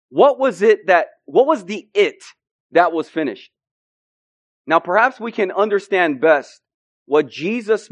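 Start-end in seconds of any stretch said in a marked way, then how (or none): 0.1-3.5 impassioned preaching. Acted. Preacher challenging the congregation, impassioned. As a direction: drive the question home.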